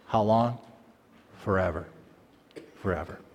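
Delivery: sinking to a murmur; hushed awe. Acted. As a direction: breathe Forever twice, fading. Preacher whispers the answer forever.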